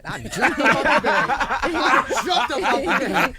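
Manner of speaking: loud, exuberant storytelling; excited outburst. Retold or imitated. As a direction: belt it out, stretching the repeat. Reliving jumping up at the hit.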